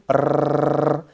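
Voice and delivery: teacher demonstrating the trill; rolled R trill. Shown as a demonstration. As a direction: roll the R hard. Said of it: "tu vois comment je roule?".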